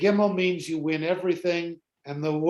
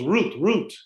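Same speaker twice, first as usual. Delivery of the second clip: root, root as a higher mock bark; dog bark; 3 semitones higher than usual. Joke's dog barks out the baseball answer. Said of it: "the dog goes".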